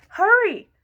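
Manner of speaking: sudden high, loud call of hurry; urgent cry. A narrator's reading. Narrator urging the little seed on, loud cry. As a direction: urge the seed on with a shout.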